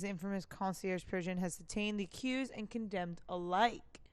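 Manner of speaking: ordinary talk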